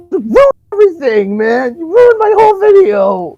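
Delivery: high, loud hammed-up tantrum; imagined Tommy raging at the kids; mock furious yell. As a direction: scream it like a spoiled kid. Imagining Tommy super pissed.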